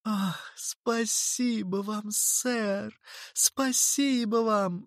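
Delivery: put-on high, slow girl's gushing thanks; grateful cry. A narrator's reading. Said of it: "вскричала Анджела".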